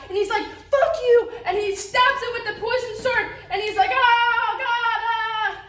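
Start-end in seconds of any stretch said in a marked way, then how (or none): 0.5-1.3 high, loud mock-furious outburst; angry Hamlet. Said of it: "Hamlet gets so angry". 3.9-5.6 high, hammed-up death wail; dying king. Acts out stabbed king dying.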